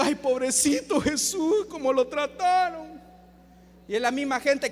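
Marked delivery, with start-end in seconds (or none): 0.0-3.0 slow, hammed-up pitying wail; mock weeping. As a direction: imitate mourners moaning with pity. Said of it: "anda llorando y diciendo". (imitando un lamento lloroso)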